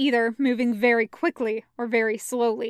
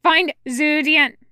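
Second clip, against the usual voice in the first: loud, slow, raised command; commanding order; 4 semitones higher, 8 dB louder than usual. A narrator's reading. Sect leader orders companion to obey, commanding shout. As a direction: shout it so nobody dares disobey. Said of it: "orders in the tone that has his enemies falling".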